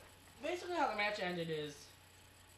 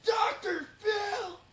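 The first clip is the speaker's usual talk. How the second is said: slow, stretched, much higher wailing shout; drawn-out crowd wail; 13 semitones higher, 10 dB louder, much slower than usual. Pretend. Host mimics crowd wailing the name, slow howl. Chanting a wrestling crowd call.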